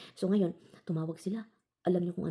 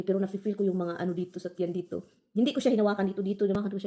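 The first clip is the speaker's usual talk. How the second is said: ordinary delivery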